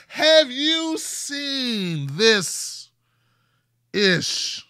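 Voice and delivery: incredulous. Real, not acted. Incredulous aside.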